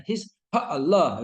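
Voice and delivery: slow "His, ha Allah" with the "sy" dropped; slow mock of a wrong "ha Allah"; wrong pronunciation. Shown as a demonstration. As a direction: drag out a faulty "His, ha Allah". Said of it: "Begitu tidak".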